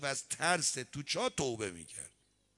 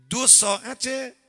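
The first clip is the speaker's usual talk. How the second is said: startled realisation; 10 semitones higher, 12 dB louder, slower than usual. Retold or imitated. Sudden realisation of lost time.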